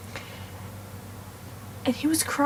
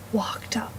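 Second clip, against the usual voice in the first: whisper; 6 dB quieter than usual. Acted. Whispered arrival of the woman.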